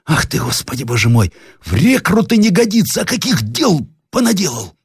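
high, drawn-out marvelling; wonder-struck high workman's voice; amazed young workman. Acted. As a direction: exclaim in astonishment, voice climbing high. Story figure: amazed young workman.

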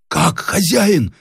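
high, drawn-out disbelief; incredulous, slow high exclamation; astonished cry. A narrator's reading. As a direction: cry out in amazement. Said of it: "вскричал с удивлением мастеровой".

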